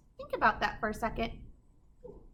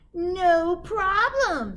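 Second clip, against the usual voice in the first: slow, drawn-out, louder witch; 8 semitones higher, 8 dB louder, much slower than usual. A narrator's reading. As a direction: stretch it out smugly like a confident witch. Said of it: "said Gritch".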